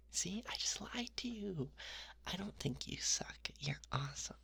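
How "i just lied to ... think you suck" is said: ordinary talk, nothing marked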